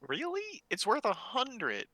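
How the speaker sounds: high, drawn-out disbelief; surprised. Real, not acted. Player questioning the gnomes' price offer, high and incredulous. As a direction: question it in high-pitched astonishment.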